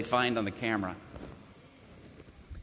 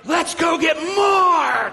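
high, slow excited yell; pumped-up cry to go get more; fired-up shout; 10 semitones higher, 4 dB louder, much slower than usual. Retold or imitated. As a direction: shout like they're on fire. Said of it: "They were like".

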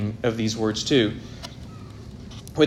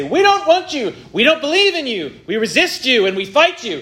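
defiant rebels; 10 semitones higher, 4 dB louder than usual. Retold or imitated. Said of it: "They say".

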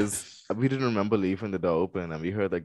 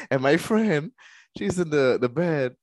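ordinary talk

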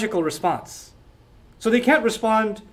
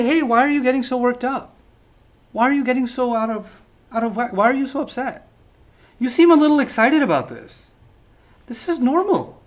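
higher-pitched, mock-concerned calming; condescending calm; 5 semitones higher than usual. Elders brushing off Ibrahim's passion, with patronizing calm. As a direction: act calm and make the other seem worked up. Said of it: "they're responding to his tone with calm".

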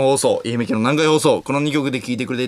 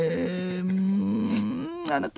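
soft, drawn-out detective pondering; 粗品任三郎; 5 semitones higher, 8 dB quieter, slower than usual. Acted. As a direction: hum and stall like a parody sleuth. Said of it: "粗品任三郎さん。". (刑事のものまねで、静かにもったいぶって)

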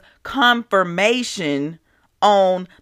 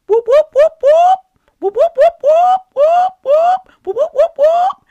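high, loud siren imitation; siren whoops; 9 dB louder than usual. Imitating the motorcycle police sirens, high and loud. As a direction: mimic the police sirens wailing.

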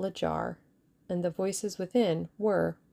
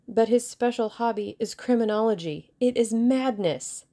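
exasperated warning; 5 semitones higher, 5 dB louder than usual. A narrator's reading. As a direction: berate her in mounting exasperation. Russian berates the woman's recklessness.

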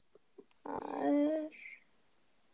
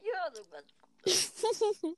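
slow, whiny teasing; mock whine; 4 semitones higher, 5 dB quieter, much slower than usual. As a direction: tease him drawn out. Mocking teammate's German. (spöttisch gedehnt)

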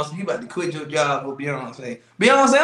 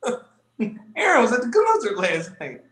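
snitch coworker voice; 7 semitones higher than usual. Retold or imitated. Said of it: "who goes in the store like".

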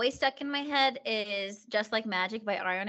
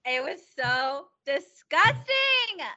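disgusted; 7 semitones higher, 4 dB louder, much slower than usual. Real, not acted. Grossed out retelling.

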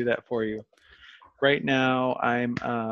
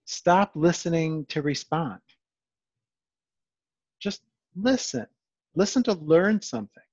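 preaching moment; 4 semitones higher than usual. Acted. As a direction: build it up like a sermon's peak. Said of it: "that's my preaching moment".

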